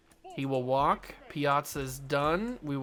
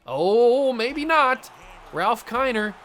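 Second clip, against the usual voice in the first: loud, high excited yelling; loud, high cheering at the screen; excited shouts; 8 semitones higher, 9 dB louder, slower than usual. Real, not acted. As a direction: cheer the hitter on at full voice. Reacting to big hits.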